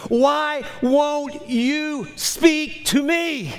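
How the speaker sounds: high, drawn-out cry to God; impassioned high-pitched pleading yell; retold scream. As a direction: re-enact a desperate yell, stretching the words. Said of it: "I scream".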